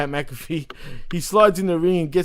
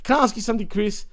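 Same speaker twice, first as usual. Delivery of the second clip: ordinary delivery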